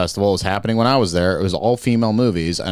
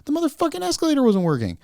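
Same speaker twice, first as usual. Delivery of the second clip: swearing tirade; 8 semitones higher than usual. Real, not acted. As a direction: vent about the escalator. Ranting about broken escalator.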